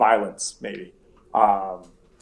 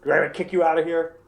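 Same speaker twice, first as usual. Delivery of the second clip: louder, blunt innkeeper demanding answers; a rough barkeep confronting a guest; loud, pushy tavern keeper; gruff innkeeper; 4 semitones higher, 6 dB louder than usual. Acted. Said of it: "He says".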